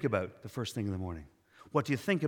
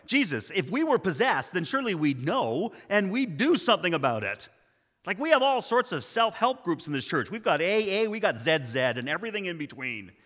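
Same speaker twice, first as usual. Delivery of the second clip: loud, higher-pitched objection; indignant preacher; 5 semitones higher, 7 dB louder than usual. Acted. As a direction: speak up in protest, loud and animated. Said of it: "she didn't like the way this was going".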